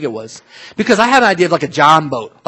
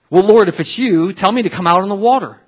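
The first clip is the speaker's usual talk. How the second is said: louder, higher reader's call; 5 semitones higher, 4 dB louder than usual. A narrator's reading. Peter calling to Jesus on the water, calling out. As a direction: read it as a raised call.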